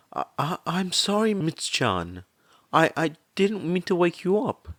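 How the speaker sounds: a tearful mother trying to hold it together; shaking apology. A narrator's reading. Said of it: "she apologized, voice shaking".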